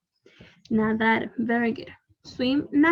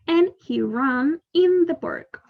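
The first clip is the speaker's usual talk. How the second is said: slow model reading; 4 semitones higher, 3 dB louder, slower than usual. Shown as a demonstration. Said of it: "teacher models the sentence slowly".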